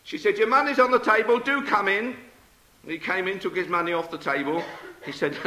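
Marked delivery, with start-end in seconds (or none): 0.4-2.2 high, loud call to the door. Acted. Trapped knitter calling the milkman in, high loud call.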